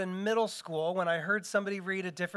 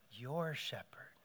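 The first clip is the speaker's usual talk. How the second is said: whisper; 4 semitones lower, 9 dB quieter, slower than usual. Real, not acted. Pointed question to the congregation.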